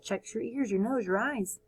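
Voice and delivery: ordinary delivery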